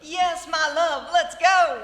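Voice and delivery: loud, slow, lovestruck cry; eager swooning. Acted. Hilda answering her suitor, loudly swooning. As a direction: gush it out, carried away.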